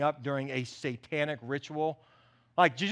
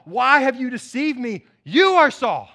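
woman accusing Saul; 7 semitones higher, 12 dB louder than usual. Retold or imitated. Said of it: "And the woman said to Saul".

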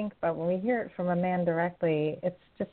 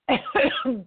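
short high cough; a small dry cough; 8 semitones higher, 3 dB louder than usual. Real, not acted. Coughing fit continues.

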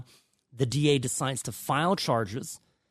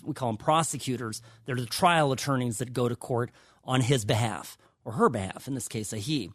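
ordinary talk, nothing marked

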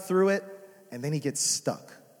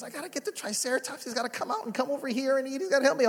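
put-on kid voice; 8 semitones higher, 5 dB quieter, faster than usual. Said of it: "He goes".

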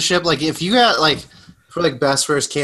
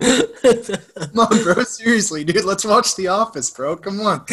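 mock whiny pleading; 7 semitones higher, slower than usual. Mock pleading peer pressure.

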